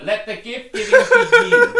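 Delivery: high, loud, slow proclamation; laughing announcement. Real, not acted. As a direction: declare it grandly while laughing. Announcing gift giving, laughing.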